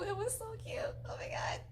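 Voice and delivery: soft, voiceless gushing whisper; overcome whisper. Real, not acted. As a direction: whisper it, nearly moved to tears. Gushing, getting teary-eyed.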